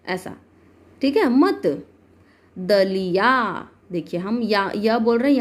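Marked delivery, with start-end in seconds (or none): 1.3-1.7 मत short, with a light final "t" and no "aa"; unopened short ending. Shown as a demonstration. Teacher giving another example without the sign, unopened short ending. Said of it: "हमको धीरे से ही बोलना है". 2.6-3.7 दलिया very slow, with a long open "yaa"; forceful long ending. Shown as a demonstration. Teacher demonstrating the aa sign on ya, forceful long ending. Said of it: "तो जोर से बोल रहे हैं".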